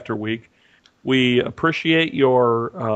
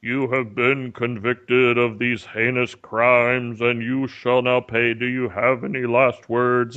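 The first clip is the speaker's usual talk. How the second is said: slow, lower, solemn announcer of doom; booming executioner voice; 4 semitones lower, slower than usual. Retold or imitated. Guide at the attraction pronouncing the death sentence, slow and solemn. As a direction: intone the sentence like a haunted-house judge. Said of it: "and it's like".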